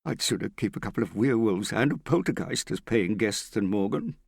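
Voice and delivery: fraught, near tears. A narrator's reading. Prudence begs Hester to deal with Morgan, fraught, near tears. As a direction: plead and grumble while fighting back tears. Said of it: "she tried not to let the tears out".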